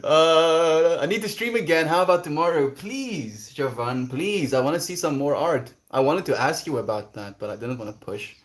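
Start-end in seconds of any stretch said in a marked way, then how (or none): 0.0-5.7 loud, high mock whine; exaggerated pretend begging; playful begging. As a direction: plead in a hammed-up way, pitched up. Playful begging to stream again.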